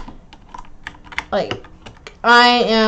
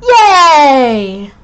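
cheer; 4 semitones higher, 7 dB louder than usual. Acted. Kids cheering camp.

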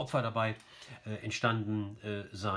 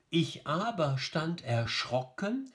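slow beats on "Ich Aber STAND erSCHROCKen"; metrical scansion; 5 semitones higher, slower than usual. Shown as a demonstration. Teacher reading the last stanzas, slow scanning.

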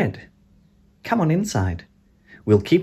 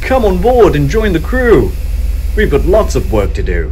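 excited call; 5 semitones higher, 7 dB louder, faster than usual. Acted. Inviting child aboard rocket.

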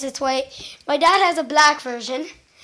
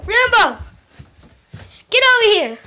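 calling the dog; 5 semitones higher, faster than usual. Real, not acted. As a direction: call him over from afar.

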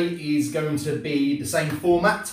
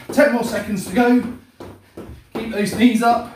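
loud, high coaching call; barking gym coach; 5 semitones higher, 4 dB louder than usual. Real, not acted. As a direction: push them through the last seconds. Calling out time during workout.

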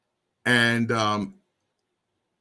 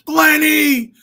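loud, high imitation of the brother's call; mocking yell; 10 dB louder than usual. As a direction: mimic him calling out. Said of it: "I'm like".

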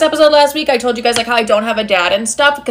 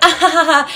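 high mock "ha-ha" laugh; imitated laughter; 5 semitones higher than usual. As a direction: act out a kitchen giggle fit. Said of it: "our laughs were like".

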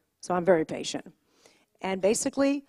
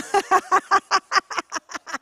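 bright high burst of laughter; 10 semitones higher, 3 dB louder than usual. Real, not acted. Retelling her son's reaction, she bursts into a high laugh. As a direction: laugh out loud at the memory.

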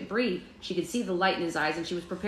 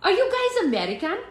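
put-on high, loud German boy voice; eager child with a badly faked German accent; cute German accent; 14 semitones higher, 6 dB louder than usual. A narrator's reading. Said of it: "said in a cute German accent".

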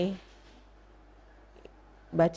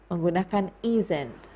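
ordinary talk, nothing marked

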